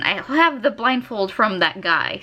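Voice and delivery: ordinary talk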